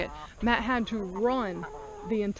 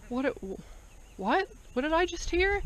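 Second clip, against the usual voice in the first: rising, higher disbelief; startled; 7 semitones higher, 4 dB quieter than usual. Retold or imitated. Husband startled by oinking behind the shed, surprised. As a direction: retell his startled double take.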